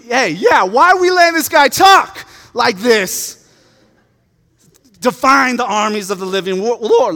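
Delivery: loud, high-pitched mock indignation; sudden righteous yelling; outraged outburst. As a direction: shout it out in hammed-up outrage. Acting David's outburst at Goliath's taunts.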